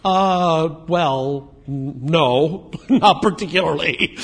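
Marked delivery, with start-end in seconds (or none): 1.7-4.2 sheepish laughing no, trailing into laughter; laughing reply. Real, not acted. Congregation member caught out by the preacher, answering through laughter.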